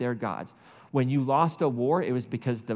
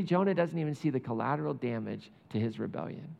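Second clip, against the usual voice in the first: usual speaking voice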